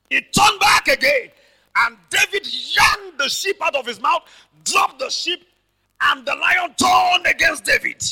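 high, loud shouted retelling of the fight; fiery preaching shout. Real, not acted. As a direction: shout the lion fight to the congregation.

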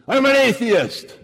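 slow, high, loud snap; mock-angry snap at the preacher; snapped retort. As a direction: bite the words off angrily. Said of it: "And he snapped at me".

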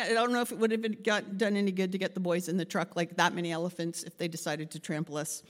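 usual speaking voice

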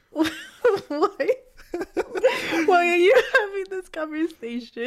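high, laughing question. Real, not acted. Friend questioning the silly British bit, laughing. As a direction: ask it through helpless laughter.

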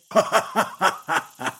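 long run of hooting monkey laughs; hooting laughter. A narrator's reading. Monkey King laughing at the tiny challenger, hooting. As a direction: hoot on and on with laughter. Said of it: "hooted with laughter".

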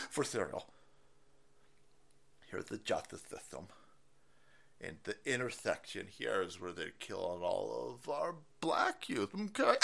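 soft, low mock-solemn explainer; Kamala Harris. Host parodying a politician explaining a diagram, hushed mock-solemn voice. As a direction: explain gravely and quietly in parody. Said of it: "renditions of Kamala Harris's speech patterns".

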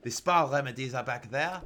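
put-on comic French-accented goblin. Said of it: "The goblin points to the back and says".

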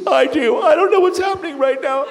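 imitating a girl near tears; overwhelmed high voice. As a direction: squeak it out, overwhelmed.

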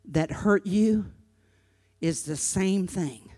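grave, gentle explanation; sober pastoral earnestness. Real, not acted. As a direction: bring it right down, with pauses. Sober lesson after mock outburst.

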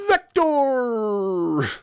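very high, loud, bright bark of the company name; imitating Adam's phone greeting. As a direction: yell the company name like an over-pumped salesman grabbing the phone. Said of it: "he would answer the phone every time".

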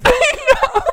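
high laughing agreement; giggling through it. Real, not acted. Agreeing with her friend, laughing.

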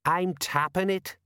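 high, slow, affronted correction; indignant, drawn-out sergeant; severe. A narrator's reading. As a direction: correct him sternly. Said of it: "said Colon severely".

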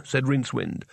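plain reading voice

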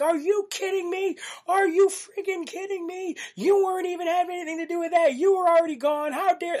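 very high-pitched mock outraged wife; wife going nuts. Wife going off at her pranking husband on speakerphone, mock outrage. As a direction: imitate an indignant tirade in a high put-on voice. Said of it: "she just goes nuts".

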